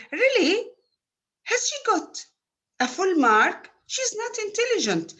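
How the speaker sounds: disbelieving "Really?", pitch leaping up; surprised intonation. Shown as a demonstration. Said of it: "I'm expressing my surprise".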